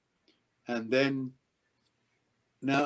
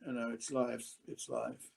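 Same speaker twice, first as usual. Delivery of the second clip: soft aside; 12 dB quieter than usual. Real, not acted. Mock-apologetic aside after swearing.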